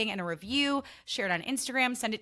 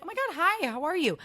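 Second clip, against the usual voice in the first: much higher, bright mock-delighted "oh my God, hi"; friendly greeting; 9 semitones higher, 5 dB louder than usual. How she'd greet someone at an event, delighted. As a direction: greet a friend with happy surprise. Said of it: "it's like".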